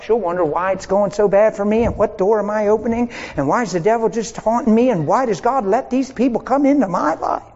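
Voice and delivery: normal talking voice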